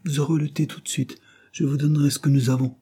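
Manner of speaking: plain reading voice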